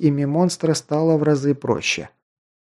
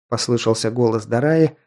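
ordinary delivery, nothing marked

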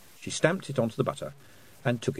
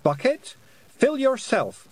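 firm command to the bucket; 5 dB louder, slower than usual. A narrator's reading. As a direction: give the order crisply. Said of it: "she commanded".

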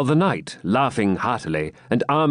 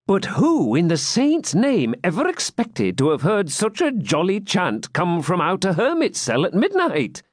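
jester voice; 9 semitones higher than usual. Acted. Wamba marvels at the song.

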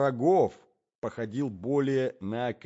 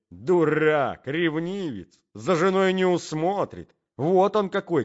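mildly indignant outburst, loud and raised; louder, higher jab at a jealous husband; 6 semitones higher, 7 dB louder than usual. Acted. Gentleman denounces a jealous husband to the young man, indignant.

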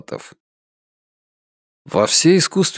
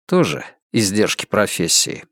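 lower-pitched echo in a man's voice; man's low reply; 3 semitones lower than usual. A narrator's reading. Dani echoes Emilia's excuse, in a lower man's voice. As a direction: drop the pitch to answer her in kind.

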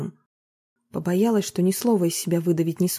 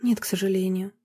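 sighing. A narrator's reading. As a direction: let the no out on a breath. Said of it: "вздохнула она".

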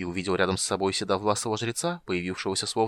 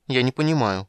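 higher, louder confused kender; admitting; 4 semitones higher, 6 dB louder than usual. A narrator's reading. Kender confesses to the goddess, higher and bewildered. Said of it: "признался Тасс".

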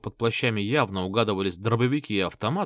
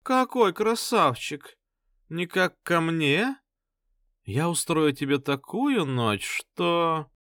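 high, slow seductive voice; flirty brunette; 6 semitones higher, slower than usual. Acted. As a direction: lure him in, sweet and slow.

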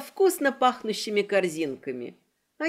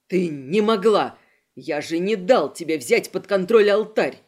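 enraged; 4 semitones lower, 5 dB louder than usual. Acted. Kristof enraged at narrator.

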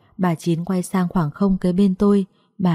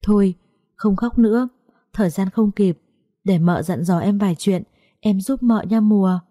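usual speaking voice

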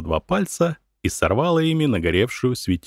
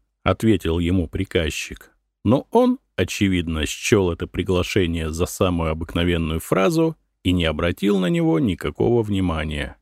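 plain reading voice

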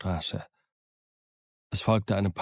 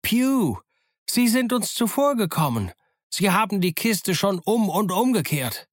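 high, urgent holler from a window; high-pitched alarmed call; 11 semitones higher than usual. A narrator's reading. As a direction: shout the news down to the street. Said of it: "Er rief zu dem blinden Bettler herunter".

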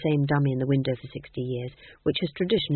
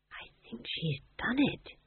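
quiet; 7 semitones higher, 9 dB quieter than usual. Acted. Magrat hushed admission.

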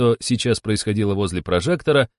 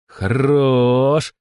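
long stretched, higher-pitched vowel; drawn-out word; 4 semitones higher, 3 dB louder than usual. A narrator's reading. Shestakova reacting to an odd clue, drawling. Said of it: "протянула Шестакова".

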